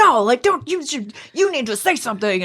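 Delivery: high, insistent imitation; amped-up friend. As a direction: urge them as a riled-up friend. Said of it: "that kinda amps you up".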